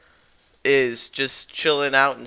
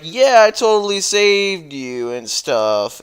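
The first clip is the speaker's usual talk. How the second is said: higher, slow, loud fake modesty; smug liar; 6 semitones higher, 5 dB louder than usual. Thief claiming he saved the man, smug loud bragging. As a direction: brag it like a lie. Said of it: "And he's like".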